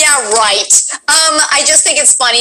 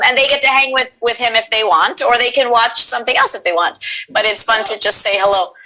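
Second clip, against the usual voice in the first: ordinary talk, nothing marked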